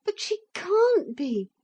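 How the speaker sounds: high, loud voice on the edge of tears; boy protesting through tears; desperate. A narrator's reading. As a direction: cry out in disbelief. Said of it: "said Philip desperately".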